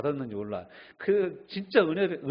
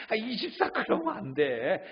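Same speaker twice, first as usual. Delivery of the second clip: higher pleading scold; 6 semitones higher, slower than usual. Retold or imitated. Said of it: "그럼 우리는 대부분 뭐라고 합니까".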